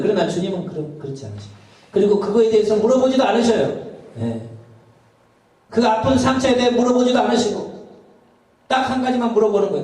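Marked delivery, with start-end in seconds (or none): 1.9-9.8 fervent preaching. Acted. Raised preaching passage.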